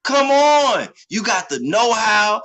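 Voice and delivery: normal talking voice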